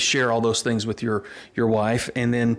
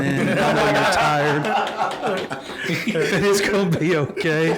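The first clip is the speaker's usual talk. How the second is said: slow, higher and laughing through the wife's comfort; laughing while quoting; 5 semitones higher, much slower than usual. Retold or imitated. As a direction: quote the wife while laughing helplessly. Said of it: "and she goes".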